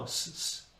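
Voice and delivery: short soft hisses of S without pitch; S as bare breath, no voice; unvoiced S. Shown as a demonstration. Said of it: "S, no".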